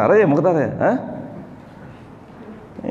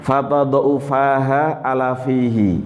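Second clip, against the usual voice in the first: usual speaking voice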